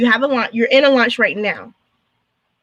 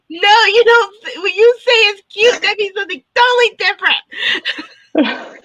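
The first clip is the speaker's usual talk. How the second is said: much higher, whining, slow at the end; mock whiny protest; 10 semitones higher, 3 dB louder, slower than usual. Client protesting to designer that cute means bad, in a high whine.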